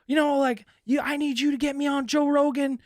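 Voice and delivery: high hammed-up caller voice; mock frantic caller. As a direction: pitch it way up as the demanding stranger.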